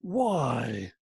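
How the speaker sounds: imitating the clip's expressive "Why?". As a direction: echo the speaker's "Why?" with its feeling. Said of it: "do you notice how expressive it was?".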